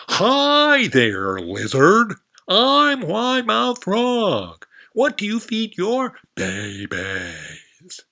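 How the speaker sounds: very high, loud, slow frog greeting; a big-mouthed frog shouting hello; wide mouth frog voice. Acted. Frog introducing herself to the lizard, high loud voice.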